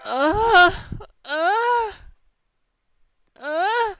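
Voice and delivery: out of breath. Real, not acted. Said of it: "I'm out of breath".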